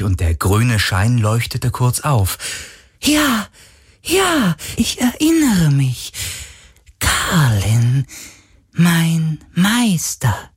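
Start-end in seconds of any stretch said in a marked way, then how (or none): 3.0-10.5 sehr hohe, jubelnde Geisterstimme; überglücklich wiedererkennen; freudiger Geist. Acted. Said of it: "sagte der Geist plötzlich freudig". (sehr hohe, freudige Geisterstimme)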